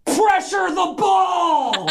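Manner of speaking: high, slow, drawn-out holler; imitated girl's yell. Imitating a little girl yelling advice to players, hollering. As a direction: stretch it out like a sideline shout. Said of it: "they started yelling".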